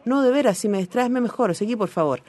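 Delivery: low, even reading of a novel passage; reading aloud. Acted. A host reading the novel's opening lines to the panel, measured reading.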